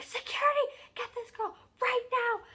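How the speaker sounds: very high, loud imitation of panicked yelling; freaking out. Crowd screaming for security to get her, freaking out. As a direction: yell it frantically, as a retold crowd. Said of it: "people are, like, freaking out".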